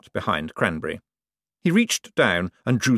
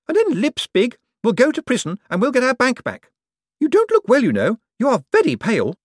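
high, loud haughty woman; shrill demands pitched way up; Poochie's voice; 4 dB louder than usual. Acted. To Cosmo, a greedy high-pitched rich woman.

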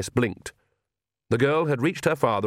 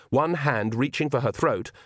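ordinary delivery

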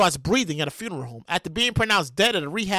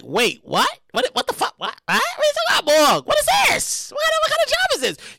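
shocked disbelief; 12 semitones higher than usual. Retold or imitated. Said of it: "I was like".

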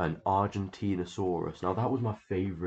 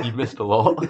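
loud, higher, cracking up with laughter; laughing; 5 semitones higher, 7 dB louder than usual. Real, not acted. Laughing at a friend who lost the thread, laughing. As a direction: burst out laughing through the words.

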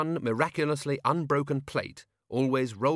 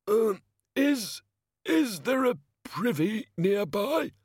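higher, slow, sickly mumble; a nauseous god begging for a toilet; sick, slow mumble through clammy lips; queasy mumble; 12 semitones higher, 3 dB louder, slower than usual. A narrator's reading. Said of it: "mumbled their burden through clammy lips".

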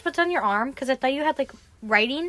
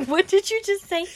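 laughing; 7 semitones higher than usual. Real, not acted. Laughing confused at friend.

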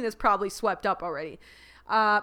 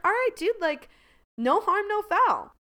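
much higher, easygoing; breezy shrug; 11 semitones higher than usual. Retold or imitated. Imagining being turned down, a high breezy shrug. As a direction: shrug it off lightly.

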